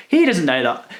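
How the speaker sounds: normal talking voice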